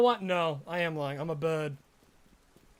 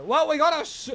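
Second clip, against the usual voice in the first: excited; 4 semitones higher, 6 dB louder than usual. Real, not acted. Shiny Slowpoke caught.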